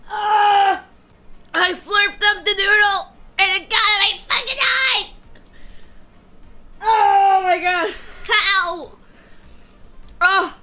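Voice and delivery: loud high cries of pain; pained yelling. Real, not acted. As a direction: yell out from the sting. Noodle splashed into his eye.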